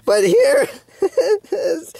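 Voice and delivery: high, slow laugh breaking through the words; disbelieving laugh. Real, not acted. To viewers at the lineup, high disbelieving laugh.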